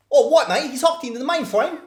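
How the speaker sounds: put-on hammy Cockney accent, half shouted. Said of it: "That was a terrible Cockney accent".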